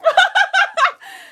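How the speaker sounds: loud high laugh; high loud cackle. Real, not acted. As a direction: crack up in disbelief. Laughing at confusion.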